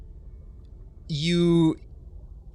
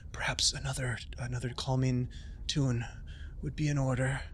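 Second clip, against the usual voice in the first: hushed and much softer, gently urging; soft whisper; 8 dB quieter than usual. Acted. Solana quietly asks the bard for a calming tune, whispered.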